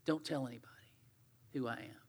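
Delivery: hushed secret whisper. Retold or imitated. Jesus whispering to his disciples, hushed and secret.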